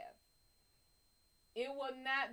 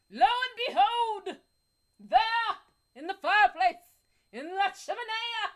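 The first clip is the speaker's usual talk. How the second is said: loud, higher storyteller build-up; dramatic reveal; 6 semitones higher, 9 dB louder than usual. Acted. Telling the chat the big twist, dramatic and loud.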